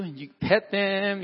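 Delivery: normal talking voice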